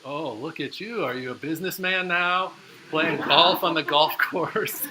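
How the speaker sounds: hammed-up incredulous ribbing; teasing parents. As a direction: imitate parents poking fun at their son, drawing it out loudly. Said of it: "they just found it was so hilarious".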